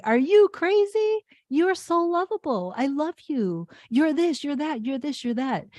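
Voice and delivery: scoop the little one up and coo reassurance; comforting a child. Pretend. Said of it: "and say".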